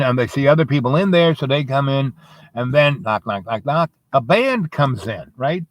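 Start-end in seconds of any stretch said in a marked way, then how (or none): none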